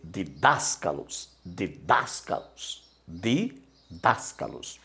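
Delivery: "didáscalos" read slowly, drawn out on "das"; pronúncia de didáscalos. Shown as a demonstration. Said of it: "Como é que você vai ler essa palavra?". ("didáscalos" devagar, com a sílaba "das" alongada)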